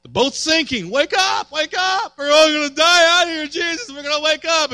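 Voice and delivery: loud, high panicked cries; frantic shouting in a sinking boat; panicked disciples. Retold or imitated. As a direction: yell like sailors about to drown.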